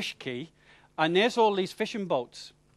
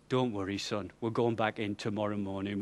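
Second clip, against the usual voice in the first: reassuring; 7 semitones lower, 9 dB quieter than usual. Retold or imitated. Skipper reassures hungover groom.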